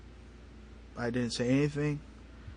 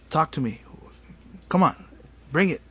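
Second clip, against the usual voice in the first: louder, higher cocky dare; gloating challenge; 4 semitones higher, 7 dB louder than usual. Retold or imitated. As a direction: crow in triumph, punching each short phrase. Retold gloating at wife.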